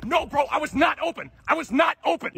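high, loud, heated complaint; worked-up, emphatic shouting; indignant protest. Acted. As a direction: protest to your teammate in a high yell. Player protests to teammate.

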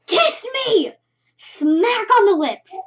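high, loud, gleeful burst; excited climax. A narrator's reading. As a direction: burst out with it, stretching the words. Excited climax after drawn-out dots.

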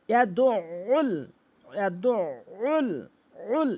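slow ইয়াদুল, ain squeezed from mid-throat; correct ain. Shown as a demonstration. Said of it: "এভাবে উচ্চারণ করতে হবে". (ইয়াদুল, হলকের মাঝখান থেকে আইন)

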